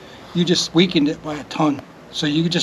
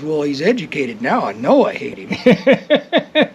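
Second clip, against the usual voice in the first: a hint of a drawn-out Southern accent, laughing; Southern accent breaking into a laugh; slower than usual. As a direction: retell the movie line in a slow Southern twang. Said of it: "Except with a southern accent".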